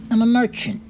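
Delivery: small, high character voice; Pimley. Acted. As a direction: introduce yourself as a high-voiced little gnome. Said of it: "you see that Pimley".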